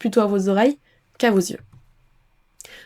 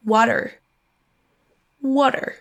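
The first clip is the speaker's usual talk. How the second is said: American "water", tapped t and r-coloured ending; American accent. Shown as a demonstration. Teacher offers the American version, American accent. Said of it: "Ou pour l'accent américain".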